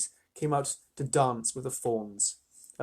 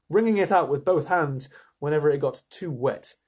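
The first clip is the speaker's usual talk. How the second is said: ordinary delivery, nothing marked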